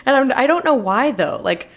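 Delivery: ordinary talk, nothing marked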